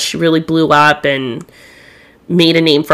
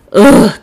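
loud, higher-pitched "ugh" of revulsion; disgusted groan; 4 semitones higher, 6 dB louder than usual. Real, not acted. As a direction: groan out your disgust, loudly. Revulsion at the man she discusses.

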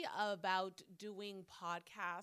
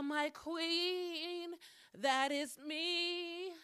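higher, bright show-host greeting; bubbly host intro; 7 semitones higher, 6 dB louder, much slower than usual. Acted. Podcast host welcoming her viewers, higher and showy. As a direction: open the show like a diva presenter.

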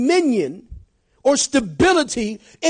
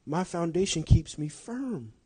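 calm Paul; 6 semitones lower, 9 dB quieter than usual. Retold or imitated. Paul answering his trials, quiet and steady. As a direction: say it with quiet certainty. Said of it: "He goes".

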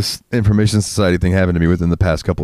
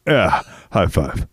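Putty voice; slower than usual. Retold or imitated. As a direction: drone it out like Puddy. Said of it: "After he comes".

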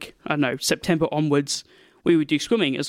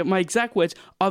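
usual speaking voice

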